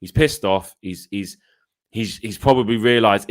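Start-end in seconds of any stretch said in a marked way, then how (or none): none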